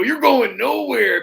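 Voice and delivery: taunting the heel walking away; Macho Man taunt. Retold or imitated. Loud slow Macho Man taunt. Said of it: "and I go".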